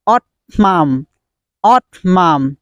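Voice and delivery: "autumn" broken as AWT-MUM; wrong pronunciation. Shown as a demonstration. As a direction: insert an M after the T in "autumn". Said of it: "Don't pronounce".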